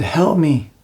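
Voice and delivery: acted-out plea to God; desperate plea. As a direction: retell a cry for help, lifting the pitch. Repeats his desperate plea.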